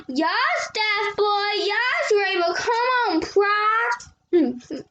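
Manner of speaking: high-pitched cheering, calling out each name; root for them like a superfan in the audience; excited fan cheer. Acted.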